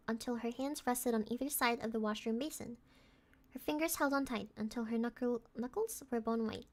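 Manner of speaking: story narration. Acted. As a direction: read the eerie text softly, drawing it out. Reads horror game text aloud.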